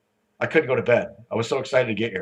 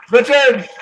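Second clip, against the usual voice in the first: loud, high-pitched summons; calling out a name; 10 semitones higher, 7 dB louder than usual. Real, not acted. Host summoning the next speaker to the stage, shouted introduction. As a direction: holler the name across the hall.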